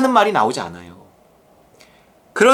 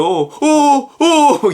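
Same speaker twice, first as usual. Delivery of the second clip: higher, louder startled uh-uh; frightened gasps; 6 semitones higher, 4 dB louder, much slower than usual. Retold or imitated. Patient watching own surgery, mock frightened gasps. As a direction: gasp in alarm at the knife. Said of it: "무서워서요".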